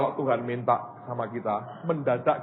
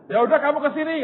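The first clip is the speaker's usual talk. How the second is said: much higher, louder eager reply; eager call; 14 semitones higher, 6 dB louder than usual. Retold or imitated. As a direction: answer the caller eagerly and high. Pak Yusak on the phone, retold.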